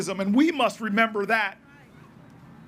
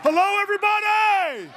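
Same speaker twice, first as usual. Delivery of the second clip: rally shout; 9 semitones higher than usual. Real, not acted. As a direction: greet the whole crowd at full voice. Rally greeting shouted to crowd.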